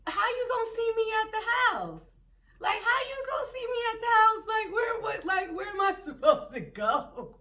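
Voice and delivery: high, loud, rapid-fire disbelief; indignant protest. Retold or imitated. Stranded cousin questions the others driving off, indignant protest. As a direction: imitate a panicked, whiny protest.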